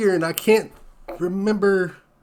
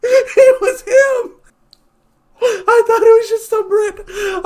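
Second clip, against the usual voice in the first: helpless laughter; 13 semitones higher, 5 dB louder than usual. Real, not acted. Realizing the bathroom stranger was the actor, laughing to tears. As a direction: crack up so hard it almost turns to crying.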